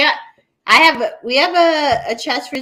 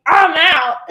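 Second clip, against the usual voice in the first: mock offended; 6 semitones higher, 6 dB louder than usual. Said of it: "got all offended".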